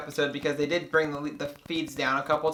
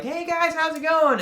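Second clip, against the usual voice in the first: high-pitched mock-chummy hello; put-on casual greeting, high voice; breezy greeting; 12 semitones higher than usual. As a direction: imitate him greeting everyone, pitched way up. Acting his fake-casual greeting to housemates.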